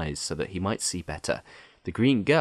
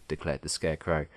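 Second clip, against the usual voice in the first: plain reading voice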